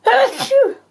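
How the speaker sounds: a real loud sneeze; sudden sharp high achoo; sneezing. Real, not acted. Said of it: "I'm going to sneeze".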